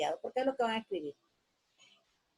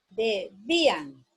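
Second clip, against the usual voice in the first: debían said slowly, syllables apart; slow dictation; 4 semitones lower, much slower than usual. Shown as a demonstration. Teacher repeating debían slowly for dictation. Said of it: "separar en sílabas".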